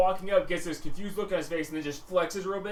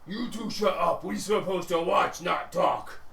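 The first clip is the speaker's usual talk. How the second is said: louder ogre voice; bossy ogre; 3 semitones higher, 4 dB louder, slower than usual. Acted. A third ogre telling the two to shut up, louder. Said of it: "you hear also an ogre".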